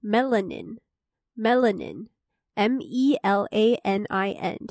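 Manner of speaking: each word said twice, then spelled out slowly letter by letter; slow vocabulary dictation. Shown as a demonstration. Host reviewing vocabulary for listeners, slow careful dictation. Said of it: "第一个是melanin".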